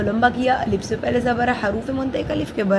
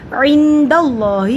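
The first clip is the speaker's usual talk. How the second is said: slow, louder recited word; recited demo word; 3 semitones higher, 7 dB louder, much slower than usual. Shown as a demonstration. Teacher models the word for students in slow chant. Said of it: "عین پڑا آگے حروف الکی نہیں ہے".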